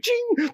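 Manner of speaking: high bright chime sound; a sharp magical ping; mouth-made vanishing chime; magic ting. Acted.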